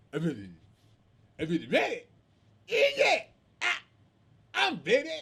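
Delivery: stammering fighter, imitated in jerky bursts; can't speak well. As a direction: struggle to get each short phrase out. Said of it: "This dude can't speak well".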